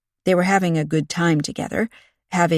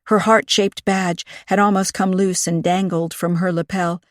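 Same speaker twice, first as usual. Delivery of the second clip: ordinary delivery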